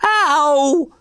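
high, drawn-out "ow"; yelp of pain. A narrator's reading. Arnold yelping as his finger meets hot oil, high cry of pain. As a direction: yelp as if burnt. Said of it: "cried Arnold, jumping in pain".

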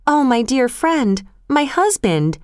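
a touch of breathless distress; breathless exclamation. A narrator's reading. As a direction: gasp it out in alarm. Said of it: "Pale and out of breath, Lucy exclaimed".

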